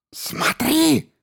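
very high whistling creature cry; shrill whistling command. A narrator's reading. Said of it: "раздался высокий свистящий звук".

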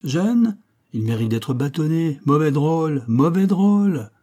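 rising indignation, slowing on the last words; indignant scolding. Acted. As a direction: scold them like a strict rector. Helstone scolds the curates.

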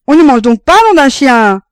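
très fort et haut, excédé; crié impatient. A narrator's reading. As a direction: hurler son impatience. Père qui crie son impatience. (père affamé criant à sa femme, fort)

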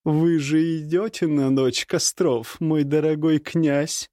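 high, slow teasing lady; flirty woman. Acted. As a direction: coax the prince playfully. Nastasya flirting, voiced. (кокетливый женский голос)

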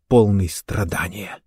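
plain reading voice

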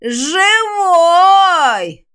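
high, loud, drawn-out screech; rising to a near-ultrasonic squeal; undead shriek. A narrator's reading. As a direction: screech it piercingly like a monster. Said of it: "едва ли не ультразвуком завизжало".

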